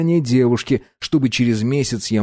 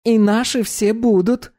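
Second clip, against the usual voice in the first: put-on bright high young woman; high girl voice; 11 semitones higher than usual. Dominika voiced.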